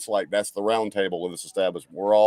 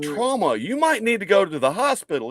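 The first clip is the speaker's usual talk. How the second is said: higher, urgent warning; alarmed; 8 semitones higher, 4 dB louder than usual. Retold or imitated. Telling the wounded guy to see a doctor, alarmed. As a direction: press him to get help. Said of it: "and we're like".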